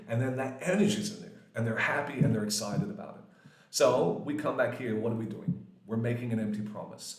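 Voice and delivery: ordinary talk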